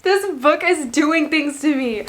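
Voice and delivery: high, drawn-out gushing oh my God; a reader's giddy swoon. Real, not acted. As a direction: swoon over the book to the viewers. Gushing over a romantic passage.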